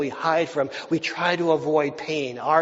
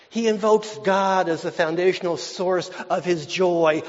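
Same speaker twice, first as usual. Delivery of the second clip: ordinary delivery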